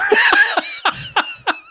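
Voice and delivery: high squealing laugh; shrieking laugh; high burst of laughter. Real, not acted. Reacts to Iris giving up.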